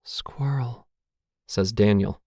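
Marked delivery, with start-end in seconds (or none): 0.0-0.9 high, breath-only word; faint, airy near-whisper; almost a whisper. A narrator's reading. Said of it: "almost a whisper".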